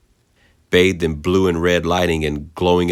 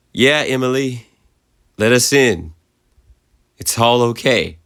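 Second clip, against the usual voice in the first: warm, gentle; 7 semitones higher, 3 dB louder than usual. A narrator's reading. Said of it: "with the warm, gentle".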